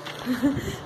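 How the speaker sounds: short soft laugh at own slip; embarrassed laugh. Real, not acted. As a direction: laugh off the mistake briefly. Laughs at her own mistake.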